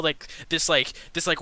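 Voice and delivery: ordinary talk, nothing marked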